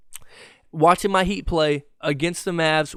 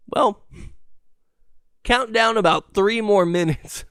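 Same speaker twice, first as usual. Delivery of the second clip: ordinary delivery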